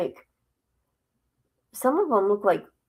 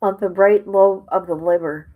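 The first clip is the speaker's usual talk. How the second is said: ordinary delivery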